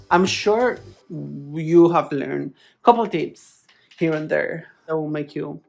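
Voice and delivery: normal talking voice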